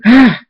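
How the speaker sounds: short, high, loud scream. A narrator's reading. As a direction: yelp out a quick scream. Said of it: "Rainbow Dash scream".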